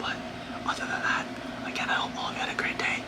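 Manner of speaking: soft unvoiced whisper; whispering over a crib. Real, not acted. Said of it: "I have to whisper 'cause the baby's sleeping".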